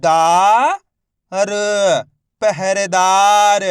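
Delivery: loud, drawn-out teacher reading; slow, loud blended reading. Shown as a demonstration. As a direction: call out each syllable slowly for the class. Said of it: "मैं किस तरीके से एक एक अक्षर का उच्चारण करके".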